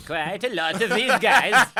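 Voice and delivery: put-on loud, high, comic Swedish accent. Swedish soldier spotting the enemy coming, hammed-up Swedish accent. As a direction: ham up a Swedish accent, through laughter. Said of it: "that's my Swedish accent".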